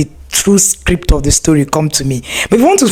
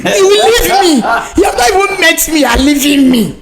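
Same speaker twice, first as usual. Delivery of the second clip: mock outrage; 9 semitones higher than usual.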